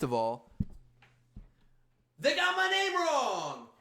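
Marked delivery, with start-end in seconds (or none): 2.2-3.7 high, slow, indignant shout; outraged yell. Real, not acted. Comic complaining to his viewers, high outraged yell. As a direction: cry out that they botched the name.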